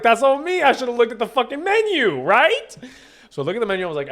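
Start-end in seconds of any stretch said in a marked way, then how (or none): 0.0-2.8 high, insistent mock protest; overwrought self-blame. Customer taking blame from nervous server, comically exasperated. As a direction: retell it pitched up, ending on a rising question. Insisting the mistake was his.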